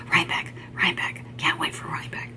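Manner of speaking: excited whisper. Retold or imitated. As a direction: whisper it eagerly. Counting days, excited self-quote.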